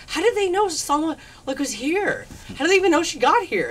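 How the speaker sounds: high, baffled questioning; incredulous retelling. Retold or imitated. Shop girl wonders who tipped paparazzi, incredulous high voice.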